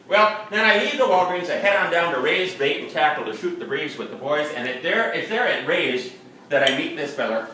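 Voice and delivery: ordinary talk, nothing marked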